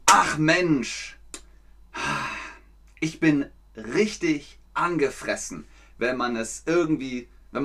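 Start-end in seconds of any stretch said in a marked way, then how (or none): 0.1-5.6 annoyed. Shown as a demonstration. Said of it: "Zum Beispiel sagt man".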